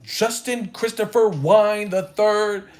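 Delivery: high, slow, drawn-out full name. Retold or imitated. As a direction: call his whole name like a prim mom. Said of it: "She was like".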